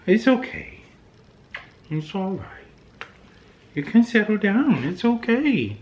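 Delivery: high, soothing voice for the dog; pet talk. Real, not acted. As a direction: coo at a worried pet to settle her. Soothing worried dog Lucy.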